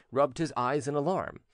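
ordinary delivery, nothing marked

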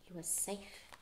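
whispered relief; soft whispered relief. A narrator's reading. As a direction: breathe it out softly. Relieved hushed narration.

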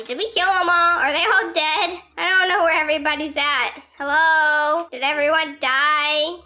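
usual speaking voice

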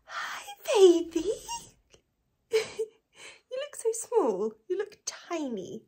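high, loud cooing at the puppy. Real, not acted. Cooing to her dog.

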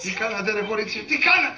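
rising panic; alarmed demand. Acted. Cousin demands what happened.

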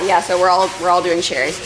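ordinary talk

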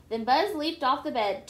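usual speaking voice

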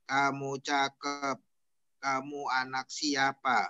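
slow, toneless "Kamu cakep, kamu anak siapa"; "Kamu cakep" read slowly on one level; flat intonation. Shown as a demonstration. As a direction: drone "kamu anak siapa" without any question tune.

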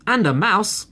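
high, loud excited squeal of little foxes; screamed. Acted. Little foxes scream to their father, high and loud. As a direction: shriek it out like greedy cubs. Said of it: "screamed two of the little foxes".

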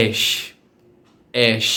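"এশ" as a simple, clean sound; careful, isolated "এশ"; normal letter S. Shown as a demonstration. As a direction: say "এশ" alone, without embellishment. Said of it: "শুধুমাত্র নর্মালভাবে হবে".

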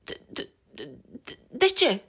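frightened whispered stutter; scared little rabbit stammering. Acted. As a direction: stumble over the words in fear. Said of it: "se bâlbâi iepurașul Barney".

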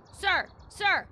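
loud, high insistent shouts; calling out. Pretend. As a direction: shout to get a stranger's attention, playfully. Calling out at game characters.

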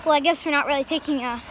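ordinary talk, nothing marked

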